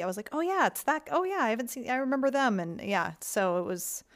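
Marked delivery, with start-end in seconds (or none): 0.3-2.6 higher-pitched pleased realization, retold; happy recognition. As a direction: act out happily recognizing familiar faces. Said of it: "I was happy to see".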